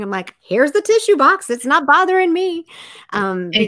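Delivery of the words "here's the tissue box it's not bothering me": high, loud, breezy brush-off; acting out an unfazed, raised-voice reply; breezy reassurance. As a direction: call it out cheerfully high and loud. Acts out her reply to criers.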